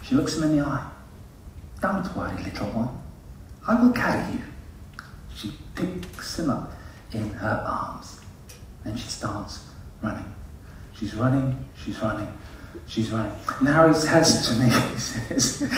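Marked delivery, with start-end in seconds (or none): 1.8-4.4 put-on soft, tender comforting of a small child; gentle reassurance. Voicing Christy comforting exhausted child. 5.3-13.3 very soft, low, suspenseful telling; hushed storytelling. Real, not acted.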